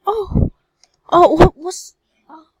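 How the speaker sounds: high, loud startled oh and what; startled exclamation. Real, not acted. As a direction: blurt it out caught off guard. Sudden surprise in game.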